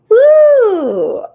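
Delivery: long, high, loud "woo"; excited whoop. Real, not acted. Coach cheering a viewer's success, excited whoop. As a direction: let out a drawn-out high whoop.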